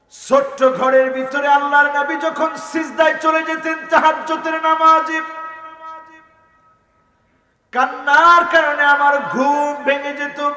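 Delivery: high, sustained sermon chant; impassioned chanting preacher; melodic preaching. Real, not acted. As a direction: intone each phrase high and let it ring.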